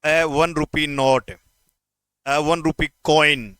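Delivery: ए वन rupee said slowly and clearly; slow teaching demo. Shown as a demonstration. Teacher slowly repeats examples to check students understood. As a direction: lay each word out slowly. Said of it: "अब clear हो गया होगा ना".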